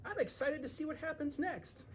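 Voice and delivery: high, different little character voice. Acted. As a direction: pipe up with a high voice.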